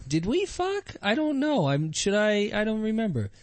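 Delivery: high, bewildered put-on voice; groggy victims waking up. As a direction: play them waking up unsure, high-voiced. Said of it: "he wants them to wake up and go".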